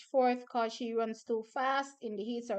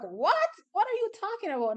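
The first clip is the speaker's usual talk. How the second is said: shocked disbelief; 9 semitones higher than usual. Retold or imitated. Retelling own shocked reaction.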